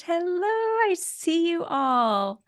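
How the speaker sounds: high, slow delighted sing-song; delighted greeting. Real, not acted. Pod leader greeting her Zoom group, slow and delighted. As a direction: beam at the faces on screen.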